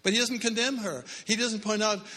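high, loud preacher's climax; preaching at full force. Acted. Preacher stressing that Jesus does not condemn, fervent. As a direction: drive the point home to the congregation.

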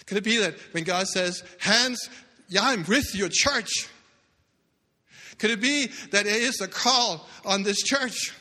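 slow, loud, high preaching; loud, slow sermon climax; preaching at full force. Acted. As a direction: drive the challenge home to the church.

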